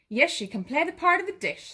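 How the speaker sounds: a hint of a cheerful cow. The cow voiced in story.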